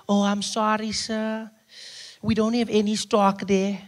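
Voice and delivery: polite shop-counter voice; a hint of a cheerful pharmacist.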